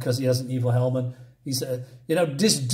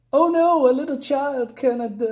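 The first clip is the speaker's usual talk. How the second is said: mock dismay; 10 semitones higher than usual. Reviewer ridicules a scene about a little child, mock dismay. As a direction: exaggerate a helpless cry of alarm.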